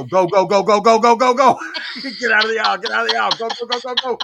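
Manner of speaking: loud, rapid-fire mock panic; urgent hurrying. Husband rushing Grammy out of the aisle, urgent. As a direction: shoo someone away urgently, pitch climbing.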